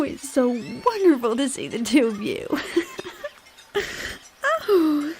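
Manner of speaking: usual speaking voice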